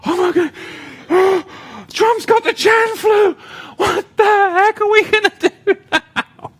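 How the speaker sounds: loud, high hammed-up panic; mock-frantic falsetto cry. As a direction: shriek it like a frightened worrier. Mock panicked worrier again, louder.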